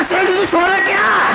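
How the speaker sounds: high, called-out question. Retold or imitated. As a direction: call out to wake him.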